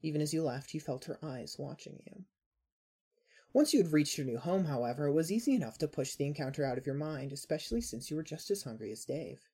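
ordinary delivery

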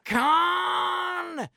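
high, loud movie scream; a full-throated mock Khan yell. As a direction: belt it out like Kirk. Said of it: "like Star Trek Two".

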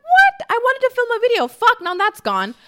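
very high, loud squeal of dismay; shocked shriek. Retold or imitated. Vlogger shrieking at the app's forecast, high and loud. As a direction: scream it like your day's ruined.

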